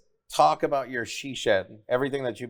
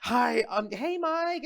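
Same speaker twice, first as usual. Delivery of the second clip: put-on much higher, sweet girlish hello; imitating the girl; 10 semitones higher, much slower than usual. Imitating the girl calling Mike, higher and sweet. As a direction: mimic her sugary greeting. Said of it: "she was like".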